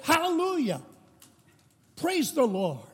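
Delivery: Pentecostal outburst. Retold or imitated. Lady jumps up again mid-service, soaring joyful cry. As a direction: leap up and call it out to the rafters. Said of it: "she jumped up again and she said".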